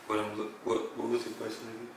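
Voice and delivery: ordinary talk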